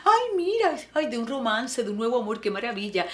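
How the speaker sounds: high, dreamy gushing; swooning delight. Retold or imitated. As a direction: gush over the song like a smitten fan. Swooning over a love song.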